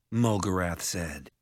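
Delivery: usual speaking voice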